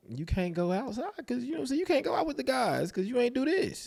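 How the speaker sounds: mock stern parent. Mocking his own strict rules.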